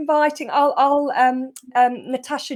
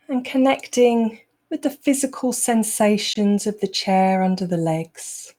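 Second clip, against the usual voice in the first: unhurried meditation voice, long pauses between phrases; guided meditation; 2 semitones lower than usual. Acted. Teacher easing the group out of a busy day, guided meditation. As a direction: guide them calmly into the present moment.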